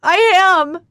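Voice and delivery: high, loud hysterical scream. Acted. Katya screams at Ford, high and loud hysterical. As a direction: scream it at him, out of control. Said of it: "I scream hysterically".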